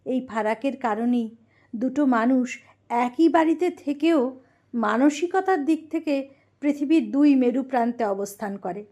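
ordinary talk

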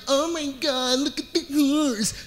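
put-on girls gasping. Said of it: "All of the girls at this moment are like".

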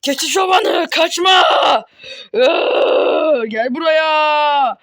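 slow, loud drawn-out taunt; loud slow mocking call; taunting yell. Real, not acted. Yelling at fleeing enemy.

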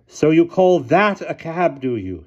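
put-on slow, loud, imperious old lady. A narrator's reading. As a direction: speak like a commanding elderly aunt.

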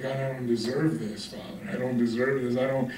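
low, hushed, unworthy plea; subdued prayer of unworthiness; humble confession. Acted. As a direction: confess softly to a father. Voices believer humbly addressing God.

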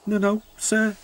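high, nervous reply; timid high-pitched denial. A narrator's reading. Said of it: "said Oliver nervously".

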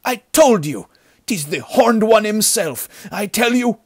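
very high, loud, frantic outburst; shrill with superstitious terror; hysterical babbling. A narrator's reading. Bandit insisting on the devil to his chief, hysterical. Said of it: "babbled the other bandit".

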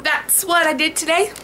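usual speaking voice

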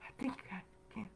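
hushed, breathy little engine puffing along; quick, whispery effort of a small train. Retold or imitated. Preacher voices the little train climbing the hill, hushed and straining. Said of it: "that little train was talking out loud".

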